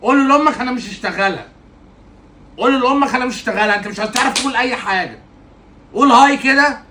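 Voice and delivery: exasperated scolding. Acted. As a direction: tell him off, you've had enough. Fed-up teacher scolding pupil. (زعيق مغتاظ في التلميذ)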